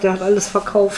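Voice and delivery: ordinary delivery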